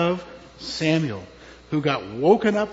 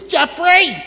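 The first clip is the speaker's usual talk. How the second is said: loud imitation of an angry mother; mock-angry mother's yell; 11 semitones higher, 10 dB louder than usual. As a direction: call the name like a cross mom. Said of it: "And she was angry".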